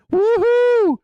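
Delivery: loud, high mock woohoo cheer; excited whoop. Streamer hams up a cheer for excited chat, loud whoop. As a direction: whoop it up like a party.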